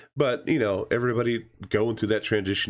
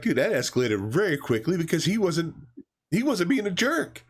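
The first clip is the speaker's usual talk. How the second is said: rising, pitched-up disbelief; mock disbelief; 9 semitones higher than usual. Reviewer reacting to the knife scene, mock disbelief. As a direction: protest the overreaction, light. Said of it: "And I'm like".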